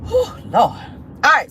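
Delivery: ordinary talk, nothing marked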